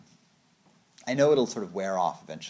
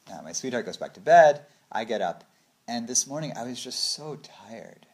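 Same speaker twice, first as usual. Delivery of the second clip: ordinary talk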